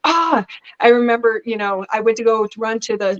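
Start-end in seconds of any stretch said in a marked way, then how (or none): 0.0-0.4 re-enacted high "ah!" of fright; short imitated cry of surprise, pitched up; startled yelp. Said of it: "So I was like".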